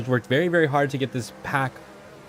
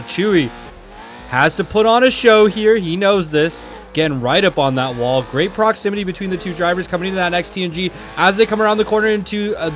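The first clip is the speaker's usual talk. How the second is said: play-by-play commentary; 5 semitones higher, 4 dB louder than usual. Acted. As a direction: lively drift commentary. Calls the battle live.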